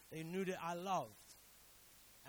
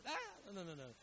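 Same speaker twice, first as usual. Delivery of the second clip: mock panicked refusal. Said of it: "As soon as they put the nail".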